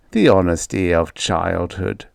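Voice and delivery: ordinary delivery, nothing marked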